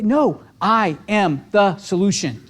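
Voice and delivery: slow and firm; firm affirmation. Shown as a demonstration. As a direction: state it slowly, every word weighted. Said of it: "Talk about affirmations".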